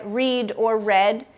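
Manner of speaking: slow, long-e READ then short-e READ; two pronunciations. Shown as a demonstration. Teacher shows students heteronyms, two pronunciations of READ. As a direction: READ said as reed, then as red, slowly. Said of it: "just pronounced differently".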